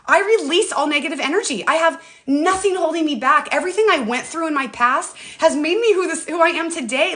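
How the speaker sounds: reciting her daily affirmations. Shown as a demonstration. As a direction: declare it like a mantra. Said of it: "So I just started saying".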